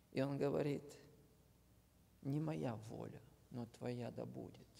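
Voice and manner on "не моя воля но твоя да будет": ordinary delivery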